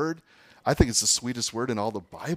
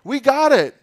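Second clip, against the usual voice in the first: much higher and louder, slowed, self-satisfied; mock smug boast; 12 semitones higher, 10 dB louder than usual. Preacher imitating the proud Corinthian church, mock smug. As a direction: show off like you've got it all figured out. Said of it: "That's where the Corinthian church was at".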